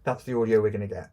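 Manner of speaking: sounding far off down a well. Real, not acted. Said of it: "you do sound like you're at the bottom of a well".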